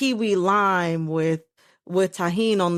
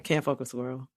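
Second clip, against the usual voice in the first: muttered; 4 semitones lower, 8 dB quieter than usual. Real, not acted. Muttered dismissal.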